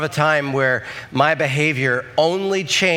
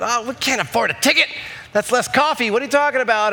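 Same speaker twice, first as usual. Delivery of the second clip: higher, hammed-up exasperation; mock panic; 7 semitones higher, faster than usual. Husband teasing wife over the ticket, mock dismay. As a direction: play up the money worry for laughs.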